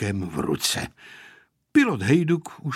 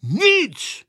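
high, loud one-word refusal; stern; 12 semitones higher, 5 dB louder than usual. A narrator's reading. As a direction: shut the question down sternly. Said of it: "odvětil přísně dědeček". (přísně a hlasitě odseknout)

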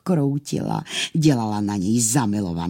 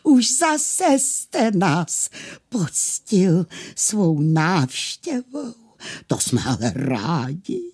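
slow, high, gushing sweetness; melting, tender; 7 semitones higher, slower than usual. Acted. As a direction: draw it out, fawning over a customer. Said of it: "potom takovým zjihlým hlasem řekl".

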